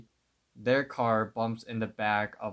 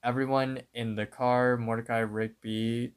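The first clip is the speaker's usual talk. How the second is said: normal talking voice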